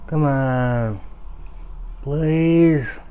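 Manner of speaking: high, slow mock begging; mock pleading. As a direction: plead sweetly, drawing it out.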